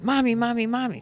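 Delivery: put-on high, loud child's call for mommy; little boy calling. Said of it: "He goes".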